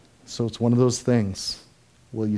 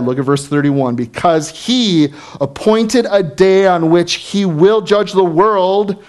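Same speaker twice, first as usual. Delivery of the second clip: loud, driving sermon climax; preaching at full force; 6 semitones higher, 8 dB louder than usual. Acted. Pastor hammering the point to the congregation, loud and driving. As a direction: preach it out to the whole room.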